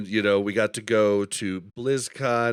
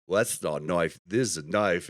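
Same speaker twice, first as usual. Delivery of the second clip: a hint of an Australian accent, lower, in character; lightly voiced Australian movie line; 4 semitones lower than usual. Fan asks for the famous line, light Australian accent. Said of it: "gets in character".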